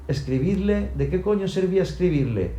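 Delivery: high, incredulous angry retort; scornful shout. A narrator's reading. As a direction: question it in outraged disbelief. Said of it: "Y padre gritó".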